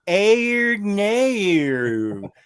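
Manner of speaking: slow, high, hugely stretched Australian vowels; comic over-the-top Aussie impression; mock Australian accent. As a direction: exaggerate an Australian accent, drawing out every vowel. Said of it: "do it in Australian".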